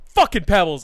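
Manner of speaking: retold yell at the dog; exasperated shout. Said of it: "I was like".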